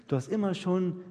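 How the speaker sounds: ordinary delivery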